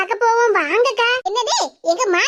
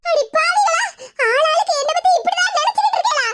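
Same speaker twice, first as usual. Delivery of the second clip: high, half-crying, half-laughing shout; mock wail; 7 semitones higher than usual. Betrayed woman cries out at her scheming relatives, mock wailing.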